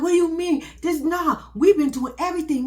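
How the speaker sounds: loud, high disbelieving outburst; shocked protest. Retold or imitated. Man protests to officer over refused transfer, shocked. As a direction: act out an alarmed protest at bad news. Retold protest at bad news.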